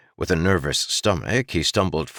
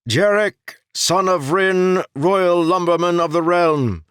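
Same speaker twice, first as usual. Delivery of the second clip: sharp monotone herald announcement, much higher and louder; herald announcing; 13 semitones higher, 6 dB louder than usual. A narrator's reading. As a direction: call the name out like a court herald. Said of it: "in a sharp, monotone voice".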